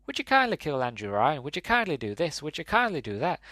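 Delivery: attempted lilting Irish voice; sing-song go at an Irish accent. Shown as a demonstration. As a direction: put on an amateur Irish accent. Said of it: "That's my attempt at an Irish accent".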